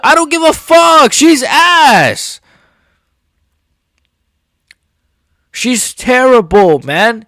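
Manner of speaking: high, loud yelling; furious shout. Real, not acted. Dismissing Shevchenko's defenders, furious high yelling. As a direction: yell it out, done with her. Raging at the fighter.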